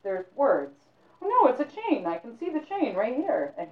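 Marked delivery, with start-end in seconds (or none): 1.2-3.5 high, loud protest; young man arguing it's a chain; insistent denial. Acted. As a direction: brush him off, certain.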